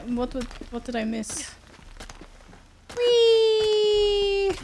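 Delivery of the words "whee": high, loud joyful squeal; like riding a slide; gleeful whee. Real, not acted. Jumping off a ledge.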